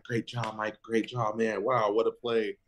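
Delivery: bright, excited teammate praise; mock cheering. Teammates cheering him on the sideline, excited.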